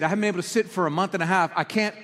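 ordinary talk, nothing marked

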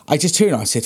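ordinary talk, nothing marked

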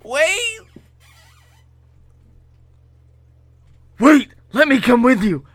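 high, loud, urgent plea; calling out. Acted. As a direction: call after someone who is leaving. Character calls after departing friend.

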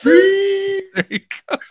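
a high, slow, playful there you go; high drawn-out mock. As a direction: stretch it slow in a high silly voice.

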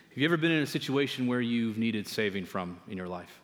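ordinary talk

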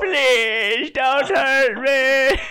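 high, slow mock whimpered plea; hammed-up pleading. Co-host playing a victim to the gunman, hammed-up pleading. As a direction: beg for mercy, overacting it.

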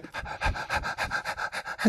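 quick unvoiced panting like a dog, no words. Shown as a demonstration. Coach demonstrates the Big Dog exercise, panting like a dog. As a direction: pant hard like an eager dog. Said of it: "and that sounds like this. You go".

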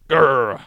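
loud rolled "grrr"; imitated engine roar; imitated car growl. Shown as a demonstration. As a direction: growl like a noisy, harsh car. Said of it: "like that".

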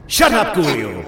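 angry snap. Acted. Host snaps at heckler.

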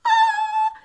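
sudden high yelp of dismay; anguished cry. Acted. Shrieking at her own inner critic. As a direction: let out a sharp high cry.

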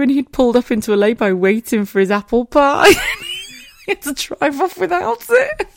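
loud high laughter through the words; laughing. Real, not acted. Recounting husband leaving the drive-thru, high loud laughing. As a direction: crack up while telling it.

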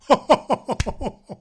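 loud high belly laugh; hearty laugh. Real, not acted. Santa laughing at his own joke, loud and high.

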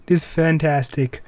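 ordinary delivery

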